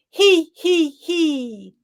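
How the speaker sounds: tittering giggle up high. Shown as a demonstration. Coach leading the group in a high he he he. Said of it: "The third one".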